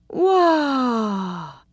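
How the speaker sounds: high, loud, breathy Wah; a woman seductively savouring his name; breathy drawn-out syllable. Acted. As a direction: draw the breath in on the first syllable. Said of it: "drew a deep breath after the first syllable".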